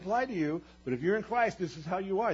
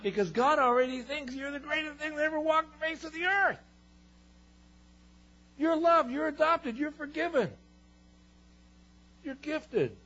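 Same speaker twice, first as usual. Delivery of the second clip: impassioned preaching; 11 semitones higher than usual. Acted. Impassioned quoted declaration.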